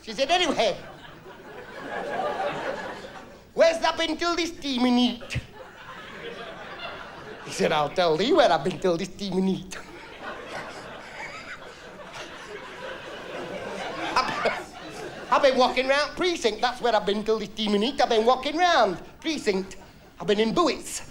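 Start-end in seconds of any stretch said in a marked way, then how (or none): 0.3-5.4 slow, high Yorkshire nag; Yorkshire wife. Acted. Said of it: "he were a Yorkshireman". 7.7-21.0 Yorkshire husband answering back, raised pitch. Acted. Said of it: "he were a Yorkshireman".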